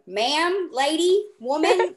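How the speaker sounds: playful escalation. Real, not acted. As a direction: ramp it up word by word, teasing.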